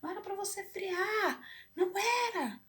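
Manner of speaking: desperate high cry from behind the wheel; frantic yelling. Retold or imitated. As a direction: scream it in high-pitched disbelief. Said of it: "Eu gritando dentro do carro".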